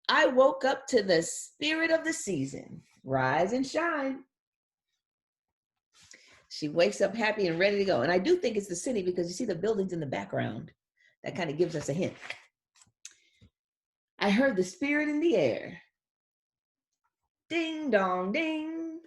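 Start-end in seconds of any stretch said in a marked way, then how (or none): 0.1-4.2 put-on bright, higher, slow little-girl voice; cheerful little girl. Reads girl's lines. 14.2-19.0 put-on higher little-girl voice, the ding dong drawn out slowly; little girl chiming. Reads girl's lines.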